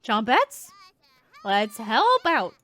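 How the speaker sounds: squeaky, loud little leader; chirpy, bossy critter voice; high loud cheerful creature voice. Acted. Chompettes called out.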